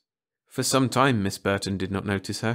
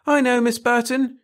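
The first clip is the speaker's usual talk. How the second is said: put-on high, loud eager child; eager cry; 7 dB louder than usual. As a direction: call out to be picked first. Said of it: "cried Frances".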